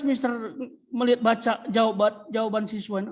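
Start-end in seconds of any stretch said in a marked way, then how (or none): none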